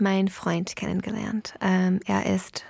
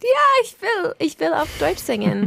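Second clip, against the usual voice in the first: excited; 8 semitones higher, 3 dB louder than usual. Retold or imitated. Eager retold thought, wanting to sing.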